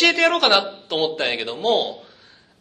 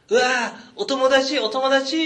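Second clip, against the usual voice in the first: put-on child; 10 semitones higher, slower than usual. Child voiced excitedly in story.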